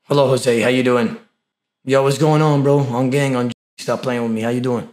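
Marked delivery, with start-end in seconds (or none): none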